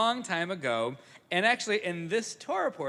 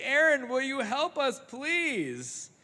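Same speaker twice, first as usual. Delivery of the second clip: higher, begging tone; pleading crowd; 9 semitones higher, 4 dB louder, slower than usual. Acted. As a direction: beg him like desperate kids. Israelites plead to Aaron.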